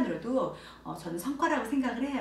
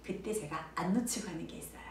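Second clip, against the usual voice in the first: ordinary talk, nothing marked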